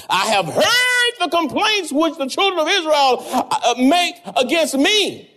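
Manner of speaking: high mock-divine voice chuckling; voice of the Lord. Quoting the Lord's words.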